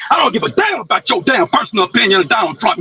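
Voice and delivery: normal talking voice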